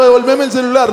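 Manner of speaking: high, loud furious yell; yelling at the thief for the phone; angry shout. Retold or imitated. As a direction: roar at the thief. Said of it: "que yo".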